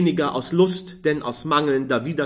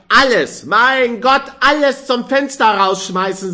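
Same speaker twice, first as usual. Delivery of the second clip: even higher, louder exultant cry; jubilant; 10 semitones higher, 9 dB louder than usual. A narrator's reading. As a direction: crow it with drunken glee. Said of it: "jubelte der Früchtehändler".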